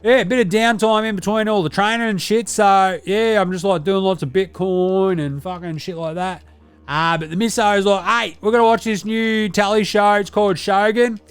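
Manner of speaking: a brash, sweary ocker lad; Deli character. Acted.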